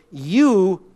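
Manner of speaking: "you" stretched out and loud; emphatic YOU. Shown as a demonstration. Preacher explaining the sense of the pronoun, stressing "you". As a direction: point the word "you" squarely at the listener. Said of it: "that's in the sense of".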